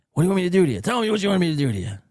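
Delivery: loud mock demand; hammed-up seducer. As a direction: push it over the top. Escalated parody of the seducer.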